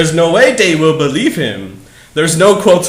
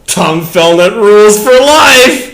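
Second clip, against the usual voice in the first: louder, slow fangirl cheer; hammed-up fan squeal; fangirl gush; 4 semitones higher, 5 dB louder, slower than usual.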